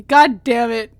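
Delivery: loud curse. Real, not acted. Repeated curse, first louder.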